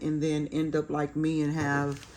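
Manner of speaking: normal talking voice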